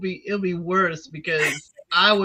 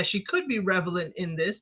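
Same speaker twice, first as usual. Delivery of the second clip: ordinary talk, nothing marked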